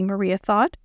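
usual speaking voice

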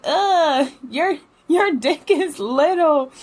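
high, slow, mocking girlfriend voice; mocking friend. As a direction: drag it out to belittle him. Friend's mocking put-down quoted.

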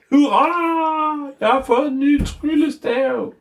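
very high, excited cheering wizard; squeaky triumphant hurrah. Acted. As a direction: crow with victory, way up high.

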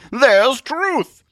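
high, loud, heralding cry; excited Zealot. Acted. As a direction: announce the sighting grandly. Zealot spots Truth.

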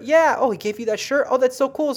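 high, bright-eyed and eager; chirpy medium. Retold or imitated. Medium gushing to the client over the shirt, high and chirpy. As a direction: hype it up like a cheerful psychic. Voicing herself during a reading.

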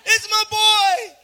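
very high, drawn-out ecstatic scream; full-out high-pitched cheering scream; screaming proud dad. Acted. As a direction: imitate a dad screaming wildly for his kid. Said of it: "That's how I think".

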